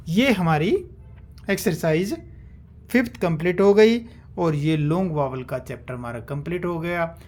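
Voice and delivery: ordinary talk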